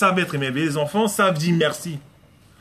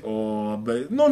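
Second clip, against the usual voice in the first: embarrassed father; 6 semitones lower, much slower than usual. Retold or imitated. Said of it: "Tu es là, tu dis".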